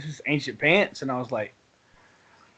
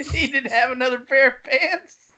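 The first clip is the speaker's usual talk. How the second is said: laughing; 8 semitones higher than usual. Real, not acted. Laughing while retelling friend's mistake.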